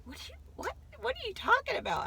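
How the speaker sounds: high-pitched, stammered mock confusion; retold bafflement. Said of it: "I could not even understand".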